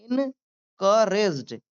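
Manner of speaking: "encouraged" very slowly, in, ka, rejd; syllable by syllable. Shown as a demonstration. Teacher demonstrating to learners, syllable by syllable. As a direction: split "encouraged" into drawn-out parts. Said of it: "मेरे साथ भी बोलिए".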